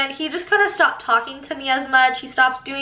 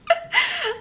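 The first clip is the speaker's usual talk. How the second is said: quick high giggle. Real, not acted. Reading her reply to the sub, quick high giggle. Laughing while reading texts.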